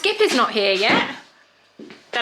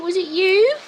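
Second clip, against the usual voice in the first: higher, playful question to the cat; pet voice; 7 semitones higher, 4 dB louder, slower than usual. Acted. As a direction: tease the cat gently. Teasing the cat.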